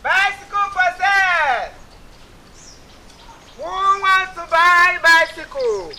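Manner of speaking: high, slow sing-song sales cry; street hawker call. Acted. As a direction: call it out like a roadside seller. Hawker calling out wares.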